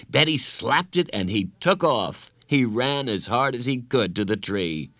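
usual speaking voice

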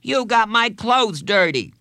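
high, loud schoolboy outrage; loud kid's indignant complaint; angry boy. A narrator's reading. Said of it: "Bradley scrambled angrily to his feet".

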